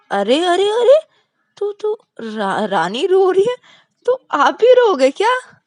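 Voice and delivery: very high, loud, teasing exclamations; mock him for crying along with her; mocking tease. Rahul teasing Vikas for crying because Rani cries, very high.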